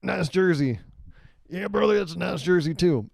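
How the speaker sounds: put-on high, throaty bro-fan voice in an odd accent; hammed-up accent of an overexcited sports fan. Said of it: "why I gave him that accent".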